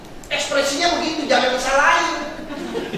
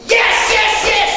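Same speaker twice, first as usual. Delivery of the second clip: high, loud cheering yes; excited shout; 9 semitones higher, 3 dB louder, slower than usual. Acted. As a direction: burst out with joy. Excited third child. (teriakan gembira yang keras dan tinggi)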